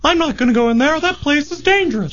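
very high squeaky cartoon voice; cartoon character voice. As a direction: pitch it way up like a cartoon. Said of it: "Just give me a cartoon character voice".

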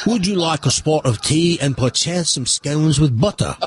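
put-on London-style English accent; imitation British accent. Radio host tries to copy British guest, imitation British accent. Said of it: "pull off this British thing".

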